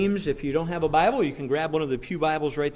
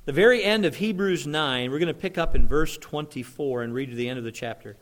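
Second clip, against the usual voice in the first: ordinary delivery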